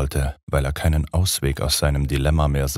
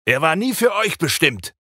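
higher furious cry, Dracula in a rage; angry shout; 14 semitones higher than usual. A narrator's reading. Dracula storming in at the women, furious shout. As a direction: hurl it in wrath from the doorway. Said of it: "mit Zorn in der Stimme schrie".

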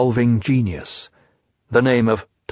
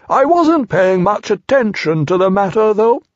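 put-on higher, louder, self-important old owl; pompous Owl; 8 semitones higher, 4 dB louder than usual. A narrator's reading. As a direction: hold forth airily. Owl voiced in story.

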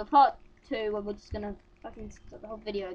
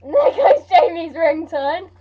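mock announcement; 6 semitones higher, 9 dB louder, much slower than usual. Mocking alarm sound.

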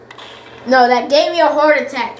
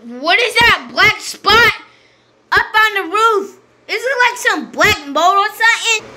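alarmed questioning; 7 semitones higher than usual. Real, not acted.

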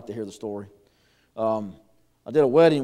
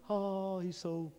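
soft, higher, dreamy mock swoon; swooning bride-to-be; 4 semitones higher, 11 dB quieter, slower than usual. Preacher mimicking bride gazing at her man, mock swooning. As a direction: imitate a smitten young woman gushing softly.

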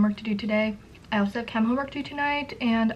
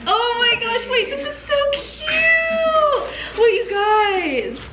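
high, squealing delight; excited squeal; 12 semitones higher, 7 dB louder, much slower than usual. Real, not acted. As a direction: squeal at something adorable. Opening a cute birthday cookie cake.

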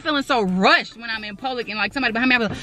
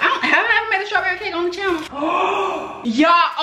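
surprised realization; 7 semitones higher than usual. Real, not acted. Sudden realization.

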